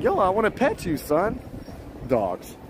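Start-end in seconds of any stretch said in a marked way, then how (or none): 0.0-1.4 higher, softer mock holler; pitched up, playful coaxing; playful call to a dog. Talking to a dog he wants to pet, playful call.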